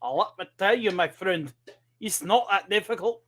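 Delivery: mock Scotsman's brogue; put-on Scottish accent. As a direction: talk like a Scotsman showing off to a friend. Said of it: "talk like a Scotsman".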